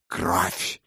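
fierce one-word demand, soft; furious bark. Acted. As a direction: snap the word Кровь at them. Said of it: "яростно бросил вампир".

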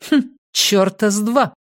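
high-pitched dismissive snort; scoffing. A narrator's reading. Nina doubting Matthias's claim, scoffing. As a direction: scoff with a little "hm" first. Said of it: "Нина хмыкнула".

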